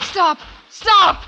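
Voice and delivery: shouted command. Acted. Woman with a gun shouting at Harry to stop. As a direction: yell it to freeze him.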